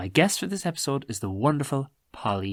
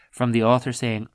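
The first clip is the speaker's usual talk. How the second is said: usual speaking voice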